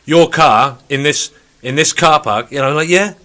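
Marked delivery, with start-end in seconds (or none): 0.1-2.5 very slow, louder, over-explaining; mocking a security guard talking down to her; patronizing slow talk. Mimicking slow-talking security guy. 2.9-3.1 higher, louder, airy and absent; pulling a vacant face at a lecturing guard; mock vacant. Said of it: "a vacant expression".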